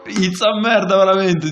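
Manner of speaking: loud, high burst of disbelief; outraged exclamation. Real, not acted. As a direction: blurt it out in disbelief. Outraged reaction to the scene.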